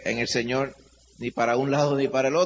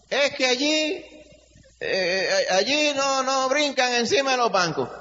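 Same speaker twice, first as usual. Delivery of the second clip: mocking complainer; 10 semitones higher, slower than usual. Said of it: "algunos a lo mejor dicen".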